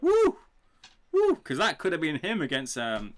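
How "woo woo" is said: very high, loud hammed-up whooping; mock celebratory whoop. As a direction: whoop like a fan watching. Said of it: "they must be like".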